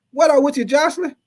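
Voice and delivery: excited greeting. Real, not acted. Host shouting out a viewer in chat, excited. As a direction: hype up the chatter.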